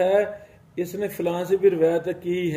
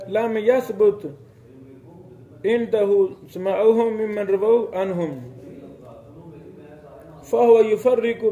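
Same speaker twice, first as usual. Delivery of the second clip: measured recitation of a classical Arabic quote; lightly intoned Arabic reading for students; reading Arabic text; 3 semitones higher than usual. Acted. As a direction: read the Arabic passage like a scholar quoting a book. Reads Arabic source text.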